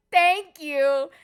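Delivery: high, loud, giddy thank-you; flustered thanks. Retold or imitated. To Usopp after his compliment, a high giddy thanks. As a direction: gush it out, starstruck.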